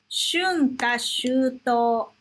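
Shunka shuto said slowly, syllable by syllable; slow model pronunciation. Shown as a demonstration. Teacher showing students Shunka shuto, slow and clear. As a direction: spell out Shunka shuto for learners. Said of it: "deixa eu te mostrar uma coisa".

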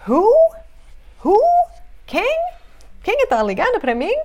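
high, curious "who?" with rising pitch; expressive questioning. Shown as a demonstration. Teacher pretending someone is phoning, exaggerated asking. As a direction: act out wondering who it is, exaggerated. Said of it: "Se alguém liga pra você".

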